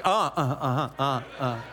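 low absent-minded uh-huh grunts; half-listening grunts as she talks on; grunt language. Retold or imitated. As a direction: grunt along without listening. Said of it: "the grunt language".

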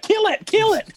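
very high, shrieky eager chant; hammed-up eager Sita; mock frantic demand. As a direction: squeal it like a bloodthirsty child.

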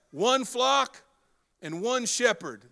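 preacher repeating the verse with delight; echoed proclamation. Acted. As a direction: relish each word. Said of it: "Man, I love that".

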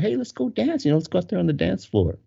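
rally friends, voice lifted; eager suggestion. Retold or imitated. Drinking friends deciding to go dance, eager.

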